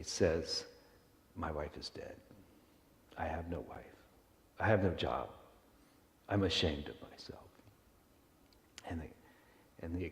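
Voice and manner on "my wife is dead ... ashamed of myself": put-on low, quiet defeated drunk; subdued, acted grief and shame; broken confession. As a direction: confess it softly with long pauses. Drunk collapses, confesses his grief.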